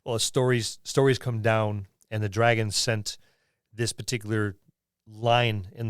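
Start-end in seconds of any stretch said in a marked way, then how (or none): none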